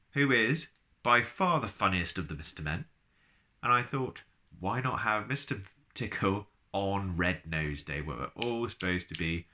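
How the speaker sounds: ordinary delivery, nothing marked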